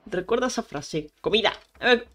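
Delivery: ordinary talk, nothing marked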